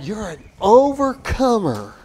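booming, high, drawn-out Southern preacher cry; exaggerated Southern twang, very loud and slow; Southern preacher accent. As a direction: belt it out as a hammed-up Southern prosperity preacher. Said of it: "why they have that southern accent".

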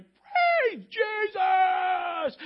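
long, drawn-out, very high and loud cry; ecstatic shout. Retold or imitated. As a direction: hold the praise out like a cry of joy. Trustee erupts in praise.

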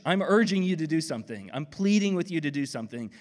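ordinary talk, nothing marked

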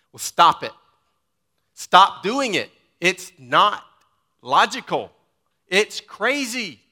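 loud, high, forceful rebuke; emphatic stop it. Shown as a demonstration. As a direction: slam the brakes on their foolishness. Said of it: "That's what Jesus is saying".

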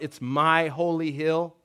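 ordinary talk

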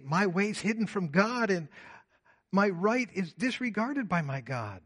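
loud, rising proclamation of Isaiah; forceful scripture reading. Acted. Pastor reading Isaiah to the church, loud and rising. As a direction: read it like a prophet calling out.

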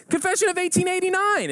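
very high, triumphant reply; hammed-up giddy certainty; excited answer. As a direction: cry it out, sure of a match. Joke: final excited answer.

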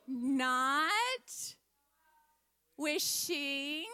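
slow, measured scripture recitation; group recitation. Real, not acted. Teacher leading children in a verse, slow, deliberate recitation. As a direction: stretch each word so others can follow. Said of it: "let's say it all together".

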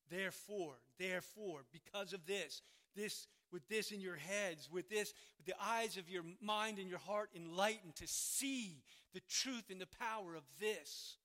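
loud, emphatic exhortation; urgent sermon build with hammered repeats; forceful preaching. Real, not acted. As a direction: press each phrase home to the congregation.

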